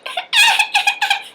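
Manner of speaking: very high-pitched staccato mock wail; squeaky acted infant cry; newborn baby cry. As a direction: imitate a newborn's first cries. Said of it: "My baby was making a noise".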